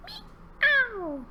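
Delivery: long, high meow sliding down; cat meow. Pretend. Ending the cat verse for children, long high meow. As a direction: draw out a big final mew. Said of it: "say meow".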